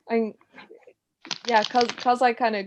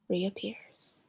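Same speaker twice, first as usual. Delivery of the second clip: hushed ending whisper; 13 dB quieter than usual. A narrator's reading. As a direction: breathe the last word out. Ending whisper of ghost story.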